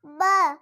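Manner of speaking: ब as a short "buh", not "bee"; phonics letter sound. Shown as a demonstration. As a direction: say ब as the bare B sound. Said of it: "इसका sound क्या होगा?". (ब की छोटी ध्वनि)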